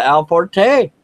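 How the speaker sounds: loud, drawn-out name with rising pitch; announcer-style introduction. Pretend. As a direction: announce the guest like a ring emcee. Host calls up a panel guest.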